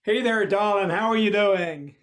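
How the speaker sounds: loud, slow drawling American greeting; husband greets his wife in a new American accent; slipped American accent. Acted. Booming slow American-accented hello. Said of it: "my accent may have slipped slightly".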